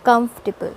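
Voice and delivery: comfortable as KUMF-tuh-bul; comfortable squeezed into three syllables; three-syllable pronunciation. Shown as a demonstration. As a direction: stress the first part of comfortable, drop the "or".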